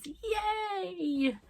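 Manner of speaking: high, soft, stretched yay; drawn-out cheer. Real, not acted. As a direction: let a little celebratory squeal hang in the air. Mock celebration closing her take.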